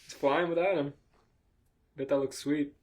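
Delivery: usual speaking voice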